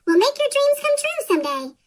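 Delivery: high squeaky cartoon candidate. Candidates campaigning, quoted.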